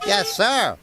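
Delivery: high little peep of an answer; eager little engines peeping high. A narrator's reading. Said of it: "peeped Rheneas and Peter Sam".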